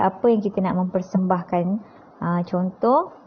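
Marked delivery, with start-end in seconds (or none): none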